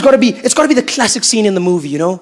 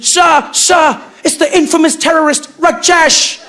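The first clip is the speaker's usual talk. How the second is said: loud, higher urgent cry; hammed-up assistant alarm; 7 semitones higher, 5 dB louder, slower than usual. Assistant bursting in to announce Rajesh, loud. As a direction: announce the villain breathlessly. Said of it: "Assistant running into the room".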